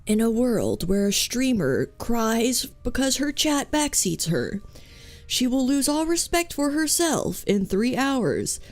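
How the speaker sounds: swooping trailer-announcer put-on; mock movie trailer. Streamer narrating her own backseated fate, mock trailer voice. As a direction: announce it like an epic film trailer.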